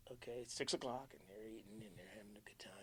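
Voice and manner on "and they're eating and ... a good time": soft whispered suspense; hushed storytelling. Real, not acted. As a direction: whisper it to build tension. Building suspense.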